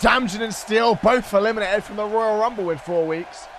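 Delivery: play-by-play. Acted. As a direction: call the match like a ringside announcer. Live wrestling play-by-play.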